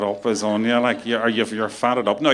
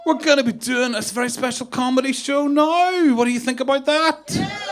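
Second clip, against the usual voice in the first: hyped-up compere impression; 10 semitones higher than usual. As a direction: pump up the crowd like a cheesy host. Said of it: "I just did a really good impression of somebody".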